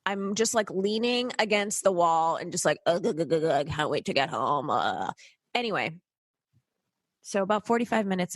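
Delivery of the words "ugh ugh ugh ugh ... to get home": tired groans. Retold or imitated. Groaning while exhausted on plane.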